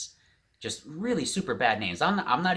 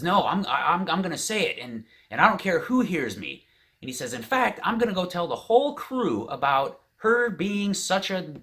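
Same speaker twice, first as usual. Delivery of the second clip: high, louder defiant rant; retold steaming anger; 9 semitones higher, 4 dB louder than usual. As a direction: imitate an angry man refusing to back down. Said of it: "he's steaming mad at this point".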